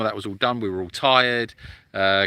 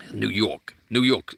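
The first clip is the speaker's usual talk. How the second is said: put-on trying out a Brooklyn accent; 3 semitones lower, slower than usual. Testing the accent on "New York", Brooklyn accent. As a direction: feel your way into Brooklyn. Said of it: "That's more Brooklyn".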